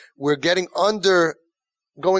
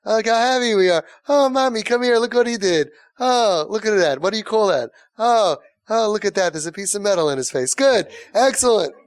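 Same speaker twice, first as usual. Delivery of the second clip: higher, louder gushing with forced praise; fake enthusiasm; 5 semitones higher, 4 dB louder than usual. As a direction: gush over the haircut like a parent faking approval. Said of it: "the fake smile".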